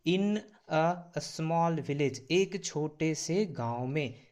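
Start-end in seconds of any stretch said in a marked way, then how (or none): 0.1-2.2 drawn-out phrase; slow model reading. Shown as a demonstration. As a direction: say it slowly for learners. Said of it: "तो हिंदी कीजिए". (धीमा उच्चारण)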